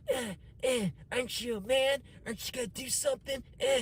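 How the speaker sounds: high, goading voice imitating the girlfriend; high-pitched mock taunt of a nagging girlfriend. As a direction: needle him like she did, pitched high up.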